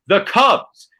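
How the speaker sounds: mock play-by-play shout of the win; a hint of a TV announcer calling it; Joe Buck call. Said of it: "you hear Joe Buck yell".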